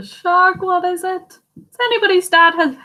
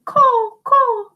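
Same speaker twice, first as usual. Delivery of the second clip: crow caw; 10 semitones higher than usual. Acted. Crow sound voiced.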